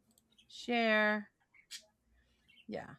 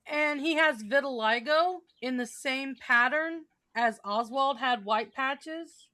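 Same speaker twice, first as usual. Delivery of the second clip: ordinary delivery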